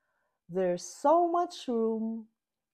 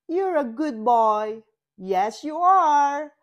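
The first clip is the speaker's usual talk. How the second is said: higher, louder doggy praise; praising a dog; 6 semitones higher, 9 dB louder than usual. A narrator's reading. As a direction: coo at a good boy. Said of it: "says Unicorn".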